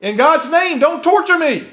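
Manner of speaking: high, loud, slow cry of a tormented man; desperate demonic shout, pleading not to be tortured; demon's shout. Acted. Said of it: "he shouted at the top of his voice".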